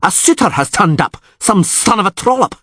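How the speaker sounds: angry ranting. Acted. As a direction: rage at the rival suitor. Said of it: "Krach an Kreit yelled".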